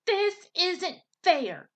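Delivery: high, slow indignant squawk; a mock squawk, high and drawn out. A narrator's reading. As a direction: protest like a stomping teen. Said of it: "stomped around the garden and squawked".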